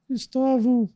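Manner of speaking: acting an unsure schoolkid, pitch cracking upward; unsure pupil. Pupil unsure of the teacher's question, squeaky uncertain answer. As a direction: voice shooting up into a high squeak. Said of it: "quando a gente não sabe o que falar".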